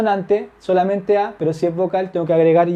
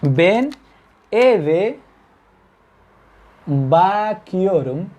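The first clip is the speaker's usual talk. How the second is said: slowly drawn out; much slower than usual. Shown as a demonstration. Teacher modelling bakiorum slowly for the class. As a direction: stretch bakiorum very slowly with a rise. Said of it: "fíjense la lógica de esto".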